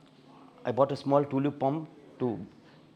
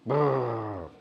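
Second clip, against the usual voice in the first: low rolling brrr like a motor; pump noise; 4 semitones lower than usual. Retold or imitated. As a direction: imitate a motor sputtering out. The pump breaking down.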